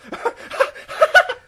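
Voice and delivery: high manic cackle; maniacal cackle. Pretend. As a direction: cackle like a lunatic.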